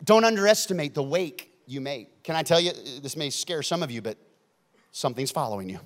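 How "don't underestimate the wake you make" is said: ordinary delivery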